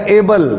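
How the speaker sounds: ایبل as English ay-bel; ایبل like English Abel; English pronunciation. Shown as a demonstration. Teacher gives viewers the English ایبل, ay-bel. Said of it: "English میں اس کا جو تلفظ ہے".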